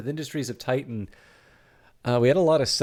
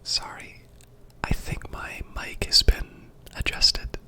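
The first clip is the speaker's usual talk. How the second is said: whispering; 7 semitones higher than usual. Real, not acted. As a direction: whisper so as not to blast anyone. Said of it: "I'm sorry I have to whisper".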